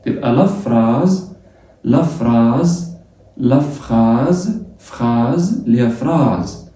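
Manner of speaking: لا فراز stretched very slowly, s as z; slow drill. Shown as a demonstration. Teacher drilling French phrase slowly for students: فراز stretched very slowly. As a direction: draw out فراز syllable by syllable. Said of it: "تتنطق زل".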